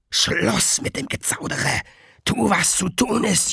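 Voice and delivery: rauher, drängender Geisterbefehl; harter Befehl. A narrator's reading. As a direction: zischend antreiben, Junge laut. Garrick drängt harsch. (Garrick herrscht Riley an)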